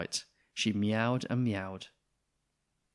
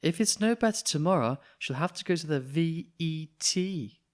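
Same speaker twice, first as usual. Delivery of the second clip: mum's voice; 6 semitones higher than usual. Acted. Mrs Thomas voiced, spelling vet.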